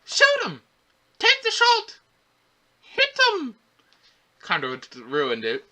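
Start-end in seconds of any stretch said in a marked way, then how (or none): 0.1-3.6 very high, squeaky mock yelling; mocking imitation. Reviewer mocking the kid's battle commands, high-pitched mock yell. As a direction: mimic the shouted orders in a silly high voice. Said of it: "I really could have done without the whole, like".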